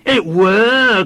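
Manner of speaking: put-on high, loud childish cry; undeterred small boy. A narrator's reading. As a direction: insist loudly like a stubborn child. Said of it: "cried the boy, undeterred".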